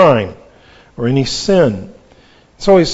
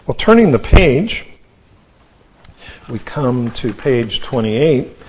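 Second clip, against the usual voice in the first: normal talking voice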